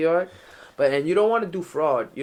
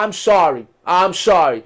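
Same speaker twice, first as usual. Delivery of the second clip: loud, high, panicky apologizing; scared pleading; 6 semitones higher, 10 dB louder than usual. Retold or imitated. Apologizing to the man whose car he hit, loud and panicky. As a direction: beg him, loud and frantic. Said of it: "I said".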